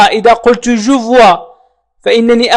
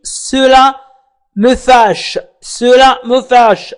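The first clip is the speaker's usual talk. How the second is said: slow model phrase; much slower than usual. Shown as a demonstration. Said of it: "teacher models French phrase".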